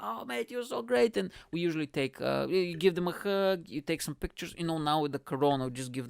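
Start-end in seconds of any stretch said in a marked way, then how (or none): 0.0-1.2 gushing fan voice. Retold or imitated. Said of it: "because they're like".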